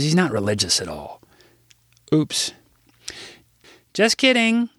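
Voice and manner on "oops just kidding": higher-pitched addressing an assembly; formal speech. Acted. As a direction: speak up to a hall of kids, a touch showy. Gives a school assembly speech.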